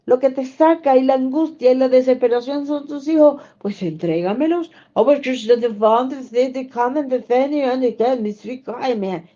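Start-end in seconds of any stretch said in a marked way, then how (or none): none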